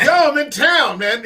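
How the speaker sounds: boisterous retold invitation; excited greeting, retold. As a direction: announce yourself like a rowdy friend in town. Acting out Flair's text message.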